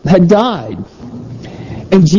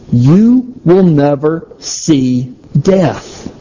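each word laid out slowly and plainly; slow and clear; 2 semitones lower, much slower than usual. Acted. Preacher quoting Jesus to the congregation, slow and deliberate. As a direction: say it as clearly as you possibly can. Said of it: "as clearly as he possibly can".

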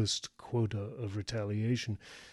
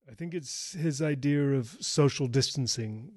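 raised, higher woman's voice; projected loudly; 5 semitones higher, 4 dB louder than usual. A narrator's reading. Joe talking to Wes across the pond, projecting. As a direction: pitch it up to carry over water. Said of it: "she said loudly to carry the twenty-five yards".

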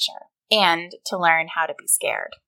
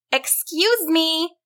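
high, slow, drawn-out call; more insistent call; 9 semitones higher, slower than usual. A narrator's reading. Susan tries the troll a second time, insistent high call. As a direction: stretch it out to get attention. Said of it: "louder this time".

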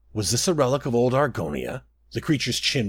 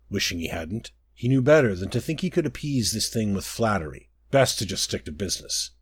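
ordinary delivery, nothing marked

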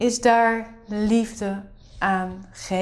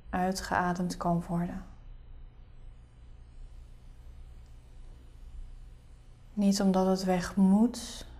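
soft spa whisper; 3 semitones lower, 9 dB quieter than usual. Acted. Leads a guided meditation.

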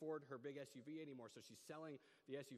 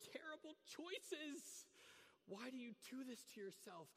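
very high, very slow drawn-out lament; exasperated wail; 11 semitones higher, slower than usual. Retold or imitated. Hearing the friend's story in bed, he wails a very high, slow lament. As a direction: wail it out like a despairing parent. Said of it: "and I just go".

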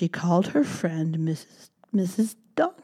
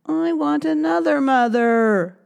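higher, whiny demand; whiny baby mouse; 9 semitones higher, 4 dB louder than usual. Acted. Baby mouse demands a new mother, high whine. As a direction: whine it like a sulky child. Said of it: "cried Baby Mouse".

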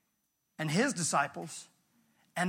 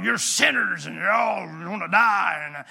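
loud, booming John the Baptist shouting at sinners; hammed-up prophet yelling doom; fiery prophet; 5 dB louder than usual. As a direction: bellow it like a wild desert preacher. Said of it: "I don't know if he talked like that".

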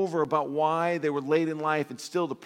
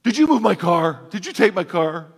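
put-on louder, higher teen accusing a parent; accusing teenager; 4 semitones higher, 11 dB louder than usual. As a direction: snap it like an affronted teen. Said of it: "The teenager".

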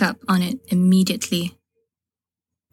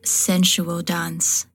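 usual speaking voice

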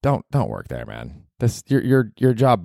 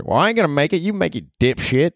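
Ruth from Ozark; 9 semitones higher than usual. Acted. Podcaster voicing Ruth, cursing at him, brash and high. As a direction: snap it like a foul-mouthed hillbilly girl.

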